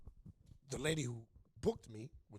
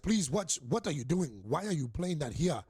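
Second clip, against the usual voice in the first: put-on loud, raised African accent, scolding; booming mock African accent, pitch swinging up and down; 4 semitones higher, 8 dB louder than usual. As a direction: imitate an indignant African man demanding answers. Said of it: "I'm doing, like, an African voice".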